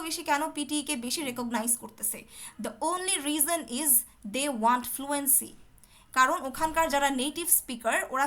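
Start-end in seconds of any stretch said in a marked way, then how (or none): 2.6-5.5 slow, deliberate, every word weighed; slow emphatic statement. Shown as a demonstration. Teacher stressing fluency to exam students, slowly. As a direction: spell it out slowly. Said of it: "slow emphatic point".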